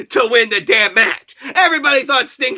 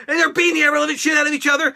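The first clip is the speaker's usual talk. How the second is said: normal talking voice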